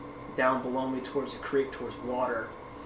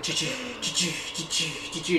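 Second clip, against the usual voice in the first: short, quick snorting puffs; imitated deer snort. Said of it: "he's just snorting at me".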